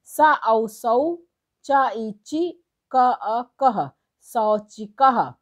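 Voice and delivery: slow, deliberate syllable blending; teacher slowly blending letters into शौचिकः; slow spelling out. Shown as a demonstration. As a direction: sound out each letter pair, then join them. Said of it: "दूसरा देखिए".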